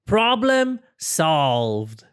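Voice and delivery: slow, satisfied announcement; proud catchphrase. Acted. Porcupine declaring his fix done, slow and proud. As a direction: savor each word.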